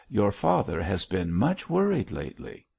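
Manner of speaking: ordinary delivery, nothing marked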